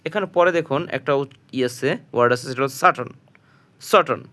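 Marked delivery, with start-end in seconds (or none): 2.8-4.2 সাটন said with a clean s sound at the start; soft c sound. Shown as a demonstration. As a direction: start সাটন with a plain hissed s. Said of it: "সির উচ্চারণ হচ্ছে এখানে দন্ত স".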